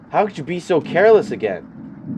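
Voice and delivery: scolding Karen. Acted. Karen scolds you for spoiled meat, loud and high. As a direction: tear into them for the carelessness.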